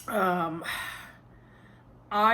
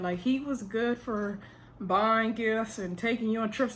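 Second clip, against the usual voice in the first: normal talking voice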